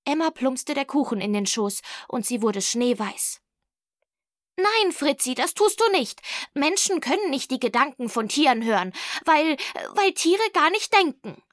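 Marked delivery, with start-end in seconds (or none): 4.6-11.4 high, emphatic protest; agitated schoolgirl objection; shocked disbelief. A narrator's reading. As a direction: refuse to believe it, pitch jumping up.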